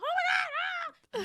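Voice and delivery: very high, slow, stretched fake scream; mock scream. Imitating Stephanie screaming when grabbed, high. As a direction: shriek like you're hurt. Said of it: "Yelling, screaming".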